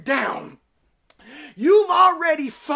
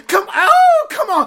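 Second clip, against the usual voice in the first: excited laugh; 5 semitones higher, 7 dB louder than usual. Real, not acted.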